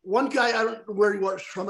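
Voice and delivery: ordinary talk, nothing marked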